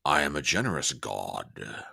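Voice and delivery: low, soft, grand god-king voice; deep, hushed imitation of a tyrant from 300; god-king movie quote. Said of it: "reference to the movie three hundred".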